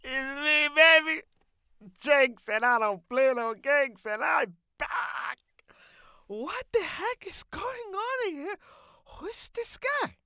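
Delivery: loud, high-pitched entrance in a put-on voice; brash comic character. Brash character barging in on the hosts, loud and high. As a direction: crash in as a brash, accented loudmouth.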